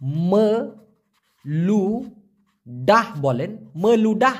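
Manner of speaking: ম লু ডাহ split into three slow syllables. Shown as a demonstration. Teacher contrasting meludah with ludah, syllable by syllable. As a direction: spell ম লু ডাহ out one beat at a time. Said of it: "লুডার জায়গায় যদি আপনি".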